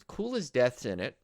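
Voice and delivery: ordinary talk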